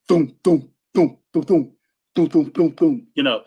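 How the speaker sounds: short dull thumps; vocal thump of a holed kick drum; imitated kick drum thump. Shown as a demonstration. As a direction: mouth a muffled kick drum. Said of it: "so it can sound like a thump".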